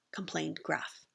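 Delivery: ordinary delivery, nothing marked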